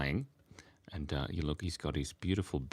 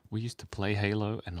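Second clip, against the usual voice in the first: usual speaking voice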